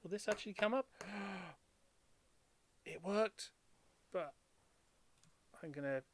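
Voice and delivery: ordinary talk, nothing marked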